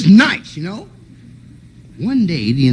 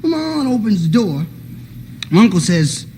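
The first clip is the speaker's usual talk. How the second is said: usual speaking voice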